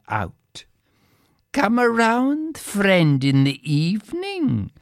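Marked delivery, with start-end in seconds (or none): 1.5-4.7 high, sing-song invitation; calling out. A narrator's reading. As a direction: call out the invitation. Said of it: "called out the rich neighbour's daughter".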